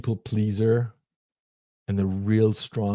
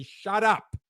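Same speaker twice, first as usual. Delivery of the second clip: high, curt dismissal; shutting it down; 11 semitones higher than usual. Pretend. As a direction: cut the thought off sharply.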